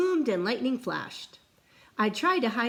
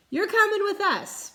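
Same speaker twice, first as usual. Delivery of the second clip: high, louder captain's command; pirate captain order; 12 semitones higher, 5 dB louder than usual. Acted. Captain tells the boy he's coming, bossy. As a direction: tell him, no arguing. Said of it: "Braidbeard told me".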